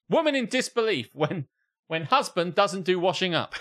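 news headline read. Acted. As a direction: announce it like breaking news. Reads a mock news headline.

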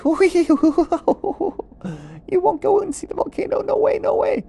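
very high, fast squeaky giggle; tiny mocking critters laughing; squeaky laughing animals. Jungle animals mock iguana.

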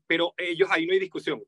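ordinary talk

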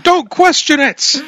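high, slow hammed-up dad voice; playful dad impression, high and slow; mock stern dad. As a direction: wave off the question as a put-on dad. Mock dad reply, high far voice.

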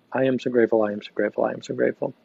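quiet falling gratitude chant; soft repetition. Shown as a demonstration. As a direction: murmur it gently to yourself. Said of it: "This is the place to just play".

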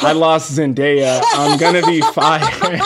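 half-laughing mock dread of being fired; mock panic. Driver retelling the panic of losing the star, slow and laughing. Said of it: "I was terrified in the moment".

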